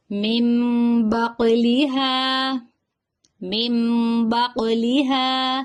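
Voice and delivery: slow drawn words with the noon turned to meem; tajweed drill. Shown as a demonstration. Teacher reciting examples for students, slow drill. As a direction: chant each example for the class to echo. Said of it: "میں پڑھاؤں گی".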